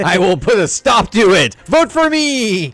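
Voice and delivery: high, loud rallying cry; mock campaign shout. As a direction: shout it like a hammed-up stump speech. Mock campaign speech as future king.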